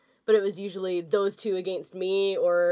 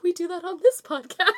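chipmunk voice; 8 semitones higher than usual. Retold or imitated. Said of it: "chipmunk voice".

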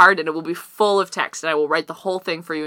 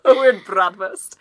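laughing; 3 dB louder, slower than usual. Real, not acted. Co-host jokes through laughter.

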